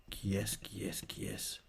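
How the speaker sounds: muttered to himself; whispered. Real, not acted. Muttering while searching. (chuchoté en cherchant)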